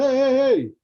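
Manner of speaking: high, loud hey hey hey; loud calling out. Retold or imitated. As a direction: hail someone across the street. Delivery guy calling out.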